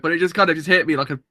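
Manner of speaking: ordinary talk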